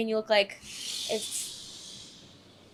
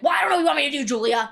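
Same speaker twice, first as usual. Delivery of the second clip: higher, louder mock dad brush-off; mimicking dad; 4 semitones higher, 6 dB louder, much faster than usual. Daughter mimics her dad brushing her off, louder and higher. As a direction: imitate dad's impatient brush-off, a bit hammed. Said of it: "he's like".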